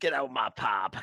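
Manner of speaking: mock British. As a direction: toss it off in caricature British, unhurried by volume. Echoing the landlord line more plainly.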